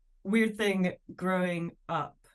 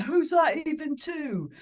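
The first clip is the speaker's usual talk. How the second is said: a much higher, slow, louder yell at the telly; mock shout; 11 semitones higher, 4 dB louder than usual. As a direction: shout rubbish commentary at the screen. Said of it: "where I just shout".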